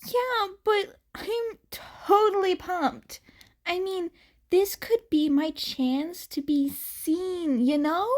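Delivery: put-on bright high girlish voice, eager; a pumped-up girl bubbling over; Uraraka's excited voice. A narrator's reading. Girl telling her friend she's pumped, high and eager.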